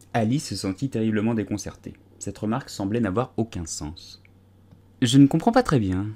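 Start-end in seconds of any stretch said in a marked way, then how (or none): none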